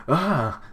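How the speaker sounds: short, high, loud "ah"; acted startled cry. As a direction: let out a startled yelp, acted. Character startled in the scene.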